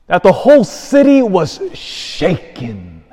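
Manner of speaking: "shaken" drawn out with heavy emphasis; stress on SHAKEN. Real, not acted. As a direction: preach it slowly and land hard on "shaken". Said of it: "I'm emphasizing that word".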